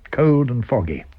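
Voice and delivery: usual speaking voice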